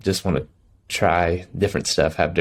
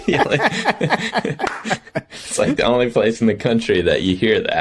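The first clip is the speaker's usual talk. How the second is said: laughing; 5 semitones higher than usual. Real, not acted. Laughing at the accent.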